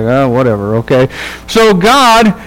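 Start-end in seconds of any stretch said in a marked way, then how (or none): 0.0-1.1 lower, offhand brush-off of a careless prophet; lazy dismissive shrug. Retold or imitated. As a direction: toss it away like you don't care, voiced lower. Balaam brushing off God's warning.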